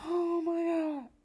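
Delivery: high, slow drawn-out wail; drawn-out exasperated cry. Real, not acted. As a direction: stretch it out in despair. Despair at another couple.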